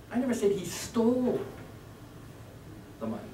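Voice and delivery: rising run up to a stretched "stole"; stress on stole. Shown as a demonstration. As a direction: land on "stole" and hold it. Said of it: "seven different meanings".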